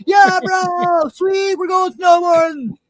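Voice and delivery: high, stretched-out stoked yell; whooping bro yell; excited holler. Retold or imitated. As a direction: shout it happily to someone far off. Hollering back, mishearing the warning.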